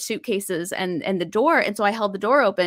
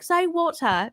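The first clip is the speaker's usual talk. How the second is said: higher-pitched British WATER, crisp T and no final R; British accent; 8 semitones higher, slower than usual. Retold or imitated. British mother corrects her American-sounding daughter, in a British accent. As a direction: imitate a British mum correcting her child. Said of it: "a woman said that she's British".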